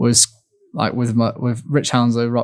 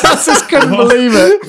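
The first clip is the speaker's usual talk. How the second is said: much higher, louder, laughing through it; 13 semitones higher, 6 dB louder than usual. Real, not acted. Athlete laughing retelling Ronaldo story, high and loud. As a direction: laugh out the disbelief.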